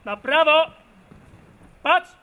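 loud barked parade command; drill sergeant. Real, not acted. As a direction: shout the order crisply. Parade ground commands at ceremony.

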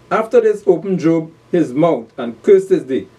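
slow, declaimed Bible verse; scripture reading. Acted. Preacher opens chapter three of Job, slow declaimed reading. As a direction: proclaim the verse slowly to the congregation.

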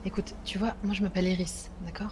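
secretive whisper. Pretend. Confiding a secret identity.

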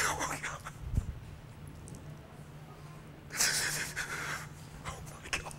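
soft breathless whisper, choked up; real choked-up emotion; overcome whisper. Real, not acted. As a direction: barely get the words out, moved.

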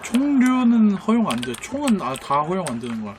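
ordinary talk, nothing marked

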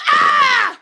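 high yelp on being woken; sudden high cry of alarm; startled scream. Acted. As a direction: scream as if jolted out of sleep. Woken with a fright.

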